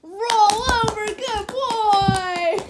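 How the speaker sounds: high, loud sing-song praise for the dog. Real, not acted. Owner praising her dog, high and loud. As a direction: cheer the puppy on, pitch up.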